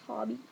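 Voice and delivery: "Tobby" with a short open AH in the first syllable; "Tobby", rhyming with hobby; pronounced TOBBY. Shown as a demonstration. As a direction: say "Tobby" like TAH-bee. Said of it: "Not Toby".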